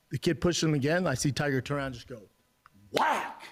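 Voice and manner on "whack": high-pitched punch noise; a sudden, high "whack"; imitated punch sound. Telling of his son's punch, a high imitated whack.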